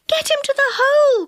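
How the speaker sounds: high, urgent fairy cry; urgent high call to drag him off; Silky crying out. A narrator's reading. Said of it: "cried Silky".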